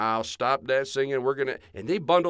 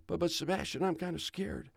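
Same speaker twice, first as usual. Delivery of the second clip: softer, higher worried voice; a hint of scared child; mild fear; 3 semitones higher, 7 dB quieter, faster than usual. Joey frightened in snowstorm.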